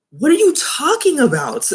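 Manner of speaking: high-pitched exasperated disbelief; incredulous challenge. Acted. Vlogger challenging Andrea's claim about her son, incredulous. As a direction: pitch it way up, as if baffled by nonsense.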